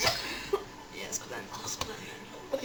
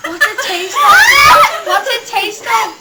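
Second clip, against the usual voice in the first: teasing; 6 semitones higher, 7 dB louder, slower than usual. Real, not acted. Teasing loud question.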